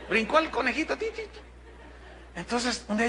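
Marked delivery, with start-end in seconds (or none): 1.0-1.4 high, soft squeaky hop sounds; hopping rabbit noise. Retold or imitated. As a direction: mimic a bunny bouncing away.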